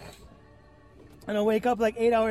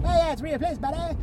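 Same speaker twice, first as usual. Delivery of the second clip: higher, louder mock Canadian accent; hammed-up Canadian buddy voice; Canadian scientist; 7 semitones higher, 4 dB louder than usual. Canadian scientist defending his ministry to a patient, mock Canadian accent. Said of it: "Ministry of Science".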